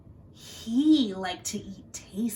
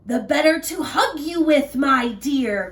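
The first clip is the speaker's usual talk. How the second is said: growling wolf; 4 semitones higher, 7 dB louder than usual. Acted. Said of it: "growled the wolf".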